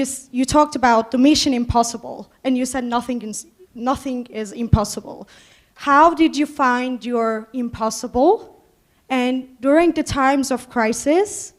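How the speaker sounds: ordinary talk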